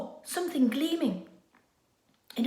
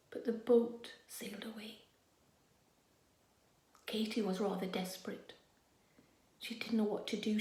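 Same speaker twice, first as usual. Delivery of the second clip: hushed disappointment; 4 semitones lower, 9 dB quieter than usual. A narrator's reading. Boat leaves her behind.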